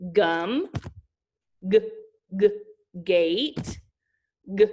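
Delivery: clipped "g, g" then the word; hard G sound. Shown as a demonstration. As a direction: stress the hard "g" before each word. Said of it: "This is some that have it as a beginning".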